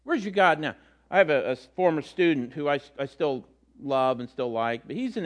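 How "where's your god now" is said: mocking taunt. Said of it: "he's got people mocking him".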